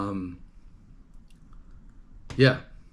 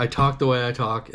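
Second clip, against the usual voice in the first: ordinary talk, nothing marked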